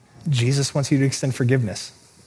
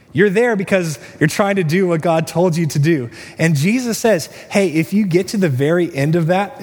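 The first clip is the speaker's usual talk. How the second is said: raised, louder preaching voice; fervent preaching; 5 semitones higher, 4 dB louder than usual. Acted. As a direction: preach it out with full force and a higher pitch. Preaching at full force, raised.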